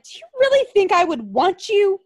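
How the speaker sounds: high, loud, scornful put-down; hammed-up snotty rejection; mock scornful woman. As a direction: sneer it in a high mocking girl voice. [name] mockingly rejects him.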